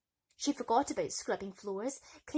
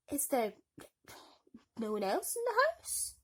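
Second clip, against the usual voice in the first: inquiring servant; 5 semitones higher than usual. Acted. Royal servant asks.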